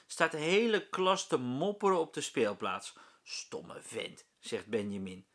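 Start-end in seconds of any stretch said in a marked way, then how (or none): 3.2-4.2 whispered grumble; muttered insult. A narrator's reading. Benjamin grumbles about the teacher, whispered. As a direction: mutter it under your breath.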